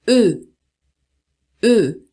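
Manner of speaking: "E" said through lips rounded for O; rounded French E. Shown as a demonstration. Teacher demonstrating the French sound to students, rounded E. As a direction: keep an O mouth and say each "E". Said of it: "conservar a boca do O e pronunciar E".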